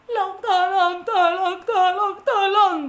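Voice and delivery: high, loud tearful cries of tolong; panicked crying for help. Acted. Sinking ant cries out to anyone nearby. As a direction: scream for help as you sink. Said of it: "kata semutnya".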